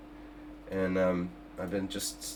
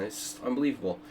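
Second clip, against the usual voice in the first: normal talking voice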